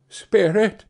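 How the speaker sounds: bow your head with the word; humble Scrooge calling the ghost; submissive Scrooge. A narrator's reading. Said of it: "said Scrooge submissively".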